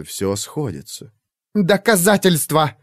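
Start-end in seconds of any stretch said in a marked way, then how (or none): 1.5-2.8 barely holding back boiling anger; trembling with rage. A narrator's reading. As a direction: voice shaking with fury, slow. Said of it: "Голос Бернардин задрожал от вскипаемой ярости".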